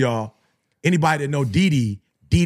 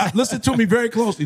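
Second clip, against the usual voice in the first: fast, pressing appeal; urgent call to listen; 6 semitones higher, faster than usual. Real, not acted. Speaker urging the men in the audience, quick and insistent. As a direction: rush it, demand attention. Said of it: "This is to all my men".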